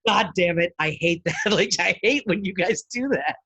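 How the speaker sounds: loud, high exasperated outburst; mock angry. Friend annoyed at fake Irish accents, mock anger. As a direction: imitate a fed-up friend fuming loudly. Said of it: "she gets so angry when".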